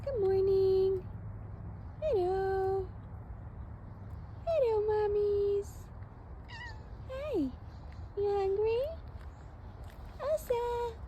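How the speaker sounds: high, soft cooing to cats. Real, not acted. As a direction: sing it up high like to babies. High pet voice greeting.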